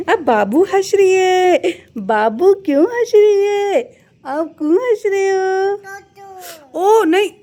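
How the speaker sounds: high drawn-out motherly sing-song. Real, not acted. Cooing at laughing baby.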